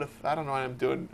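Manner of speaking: ordinary talk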